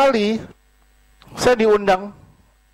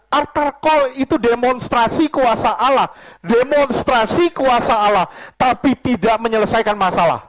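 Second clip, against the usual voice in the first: raised, forceful pulpit declaration; emphatic preaching; 8 semitones higher, 4 dB louder than usual. Real, not acted. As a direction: hammer the point into the congregation. (khotbah tegas dengan suara lantang)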